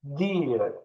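normal talking voice

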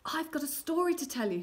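high, loud, out-of-breath urgency; panting. A narrator's reading. Lad has run upstairs with news, panting. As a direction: blurt it as if winded. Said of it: "he panted".

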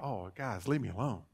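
woken sleeper. Acted. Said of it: "awakened out of a deep sleep".

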